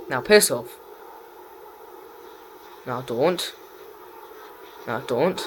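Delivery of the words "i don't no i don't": ordinary delivery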